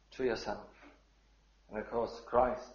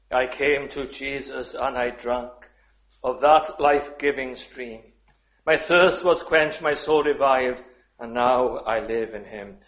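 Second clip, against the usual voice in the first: hymn reading, louder; 6 dB louder than usual. Acted. Reads hymn aloud.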